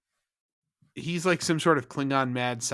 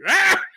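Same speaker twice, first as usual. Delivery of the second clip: high loud alarmed "Ah"; panicked scream; 11 dB louder than usual. Retold or imitated. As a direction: cry out in sudden fright. Retold panic on the bridge.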